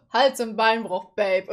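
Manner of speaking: low, slow mock of the dude; mock smooth boyfriend. Streamer mocking the random dude's line, low slow voice. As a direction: drawl it like a cheesy boyfriend. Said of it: "der kommt so".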